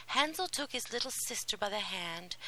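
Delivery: ordinary delivery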